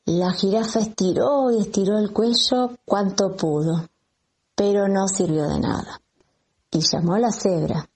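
ordinary delivery, nothing marked

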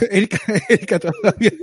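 laughing through the words; giggling while teasing. Real, not acted.

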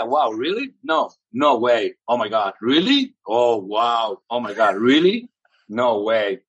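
slow, acted-out astonished catchphrases; demonstrated amazement. Shown as a demonstration. Guest showing how he survived in English, mock amazed. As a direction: play wowed in English, each "really" rising. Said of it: "Así".

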